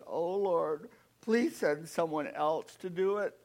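lightly voiced reluctant Moses; pleading Moses. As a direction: beg the Lord to send someone else.

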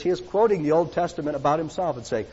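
normal talking voice

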